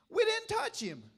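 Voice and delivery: high, slow, insistent protest of innocence; defensive denial. Retold or imitated. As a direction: plead it like a caught kid. Boys deny killing goat.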